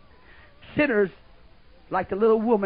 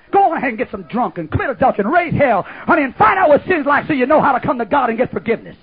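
loud, higher shouted exhortation; preaching shout; 5 semitones higher, 7 dB louder than usual. Acted. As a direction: shout it at the congregation.

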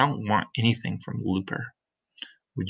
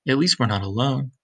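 ordinary delivery, nothing marked

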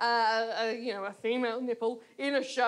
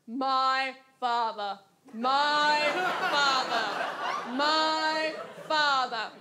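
slow, drawn-out theatrical lament; mock tragic actor; much slower than usual. Mock dramatic art performance.

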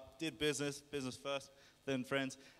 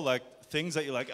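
ordinary talk

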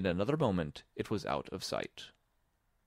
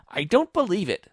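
high, loud bird retort; high-pitched flat refusal; disbelieving pigeon; 10 semitones higher, 9 dB louder than usual. A narrator's reading. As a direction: reject it as an irate pigeon. Pigeon rejects Alice's claim.

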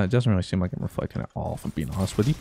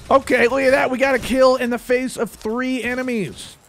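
higher, louder hype; excited brag; 11 semitones higher, 4 dB louder than usual. Real, not acted. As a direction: show off the kill proudly. Bragging about kill.

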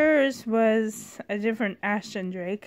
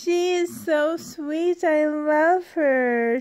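high slow doting coo; 5 semitones higher, 5 dB louder, slower than usual. Real, not acted. Cooing over a doll.

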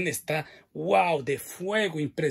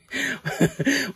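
a higher burst of giggling; high giggle; 7 semitones higher, 3 dB louder than usual. Real, not acted. Host laughing as the shoe falls, high giggle. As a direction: laugh at yourself.

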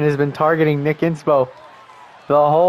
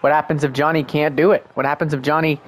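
ordinary talk, nothing marked

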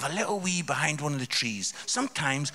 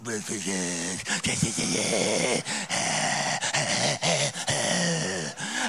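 wordless jabbering and braying noises; imitated teenage chatter; 6 semitones lower than usual. Comic mimicry of fifteen-year-old lads chatting, wordless noise. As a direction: mimic lads' chatter as drawn-out gibberish. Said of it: "it's not so much chatting, is it? It's more like".

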